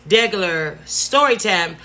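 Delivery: ordinary delivery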